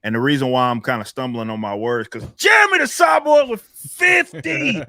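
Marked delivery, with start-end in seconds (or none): 2.4-4.8 very high, loud announcer-like whoop; astonished shout. Real, not acted. Host shouting out a fan's fifty gifted memberships, astonished shout. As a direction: yell it out in real amazement. Big gift of memberships arrives.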